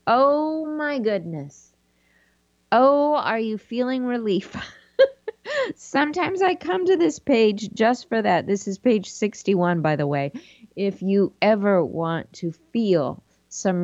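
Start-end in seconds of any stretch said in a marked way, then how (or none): 4.6-8.5 laughing. Real, not acted. Laughing at relief page.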